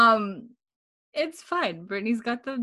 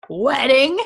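high, loud shocked "Wedding?"; choked surprise; 6 semitones higher, 9 dB louder than usual. Retold or imitated. Murtaugh hears of the wedding, shocked and choking. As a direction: nearly choke on the word. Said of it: "almost chokes and is like".

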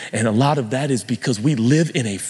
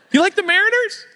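chatty fan; 5 dB louder than usual. Retold or imitated. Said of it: "fan's question".

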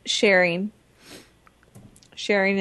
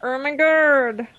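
meme voice; 6 semitones higher, slower than usual. Pretend. Slow drawn meme exclamation.

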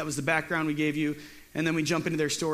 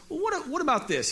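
much higher squeaky protest; whiny objection; 10 semitones higher than usual. Retold or imitated. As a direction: squeak out one more excuse. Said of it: "And Gideon still says".

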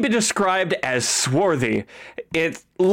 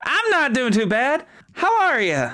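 Marjorie voice; 9 semitones higher than usual. Acted. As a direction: greet them warmly as a rugged woman. Marjorie introduced, GM voices her.

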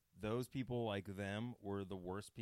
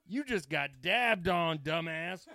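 loud, high, drawn-out gloating shout; triumphant taunt; 8 semitones higher, 11 dB louder, slower than usual. Retold or imitated. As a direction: crow it at them as a retold comeback. Retold triumphant comeback to snobs.

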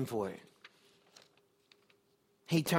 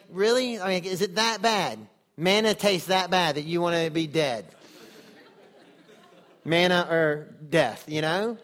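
louder, half-laughing incredulous God; amused disbelief; 6 dB louder than usual. Retold or imitated. God chuckles at Moses asking to die, amused disbelief. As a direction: tease him like a friend who can't believe it. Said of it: "I don't know if God was laughing at Moses".

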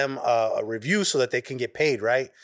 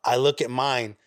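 ordinary delivery

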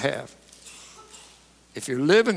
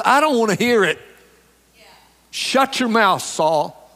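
louder, raised-pitch cut-off; emphatic rebuke; 5 semitones higher, 4 dB louder than usual. Acted. Preacher paraphrases Samuel silencing King Saul, emphatic rebuke. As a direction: shut him down forcefully. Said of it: "Exclamation mark".

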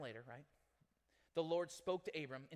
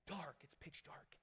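dropped almost to a whisper on "pitch dark"; hushed pitch dark; 12 dB quieter than usual. Shown as a demonstration. Telling the church about the dark night sky, in a near whisper. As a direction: breathe "It's pitch dark" out with barely any voice.

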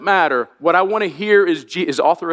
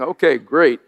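usual speaking voice

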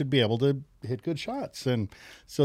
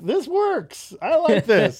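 much higher, excited exclamation; delighted realization; 12 semitones higher, 4 dB louder, much slower than usual. Retold or imitated. Golfer finding that no-ball golf works, delighted. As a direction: burst out high with gleeful discovery. Retelling his delight after birdie.

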